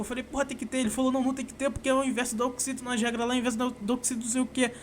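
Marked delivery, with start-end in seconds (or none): 0.2-0.8 retold heated argument. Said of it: "a gente ficou ali batendo boca". 1.1-4.7 friend's retold argument. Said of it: "a gente ficou ali batendo boca".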